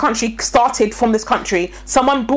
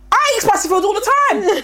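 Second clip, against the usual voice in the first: much higher, laughing boast of a spice fan; mock British boast; 9 semitones higher than usual. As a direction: puff it up like a proud Brit, giggling. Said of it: "And they're like".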